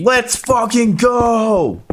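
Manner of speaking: high, drawn-out hype voice; Sidu impression. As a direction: stretch it out high and slow. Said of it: "I sound like Sidu when I say that".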